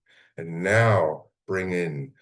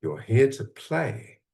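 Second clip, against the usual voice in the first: "play" drawn out slowly and pitched higher; stress on play; 5 semitones higher, slower than usual. Shown as a demonstration. Coach stressing to listeners that life is play, slow emphasis. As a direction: lean into "play" and stretch it out. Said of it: "I emphasize the word play".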